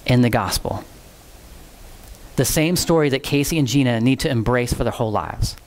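low-pitched, measured, with long pauses; solemn preaching appeal. Real, not acted. Preacher closing his message to those struggling in faith, solemn. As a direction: bring it down low and speak earnestly to the listeners.

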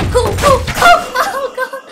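rising high, excited squeal into a laugh. Real, not acted. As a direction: get giddy, voice climbing, then break into laughter. Excited by a game hit.

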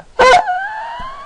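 a sudden shrill giggle, far above his voice; high-pitched giggle; squeaky high laugh. Real, not acted. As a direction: burst into a tiny high laugh. High burst after singing.

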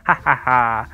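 low, deliberate villain's "ha, ha, ha"; mock evil laugh. Player gloating over a fake rush trick, mock evil laugh. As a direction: laugh like a pretend scheming villain.

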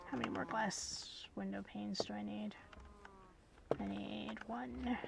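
hushed whisper to herself. Real, not acted. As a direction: whisper it under breath. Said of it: "whispering while playing".

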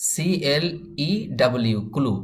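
drawn-out classroom spelling of সিএলইডাব্লিউ; teacher spelling a word slowly for learners. Shown as a demonstration. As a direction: spell সিএলইডাব্লিউ slowly letter by letter, then say clue. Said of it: "এই ক্লুটার spelling কেমন হবে".

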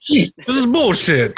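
high, drawn-out comic complaint; mock outrage. Playing the cheated watch buyer.

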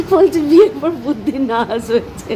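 repeating the joke through laughter; laughing. Real, not acted. Repeats husband's joke while laughing.

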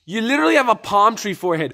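high, loud, fast jeer; mocking outburst. Mocking the director's hair after his insult, loud jeer. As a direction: jeer back in disbelief.

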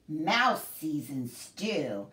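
ordinary delivery

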